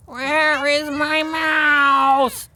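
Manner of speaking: high, very slow, drawn-out cat voice; the cat demands its mouse in a high drawl; cat's voice. Acted. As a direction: stretch it out like a cat talking. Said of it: "if Simpkin had been able to talk, he would have asked".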